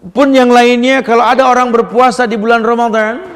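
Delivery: loud, high fervent sermon; full-force preaching. Acted. Preacher proclaiming the virtue of fasting, loud and high. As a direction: proclaim it with fire.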